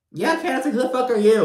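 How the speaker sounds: very high-pitched, incredulous put-on voice; mock indignant challenge. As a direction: challenge her in a squeaky mock outrage.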